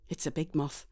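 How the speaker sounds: usual speaking voice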